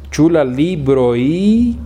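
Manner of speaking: very slow, each word separate, stressing chu; slow correction. Shown as a demonstration. Teacher corrects the student's question slowly. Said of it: "Le falta la io".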